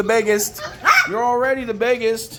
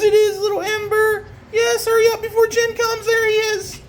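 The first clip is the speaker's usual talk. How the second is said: much higher doting coo to the pups; 13 semitones higher than usual. Real, not acted. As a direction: gush over the little puppies. High baby talk to puppies.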